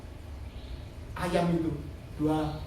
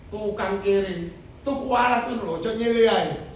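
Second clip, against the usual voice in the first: raised, louder retold jeer; mock scolding; 4 semitones higher, 6 dB louder than usual. Resentful workmen picking on the newcomer, mock scolding. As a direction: imitate hostile workmen having a go. Hostile fellow workmen acted out.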